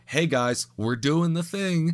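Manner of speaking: high, drawn-out mock announcing; mock announcement. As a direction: announce it slowly like a smug show. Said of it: "You're like".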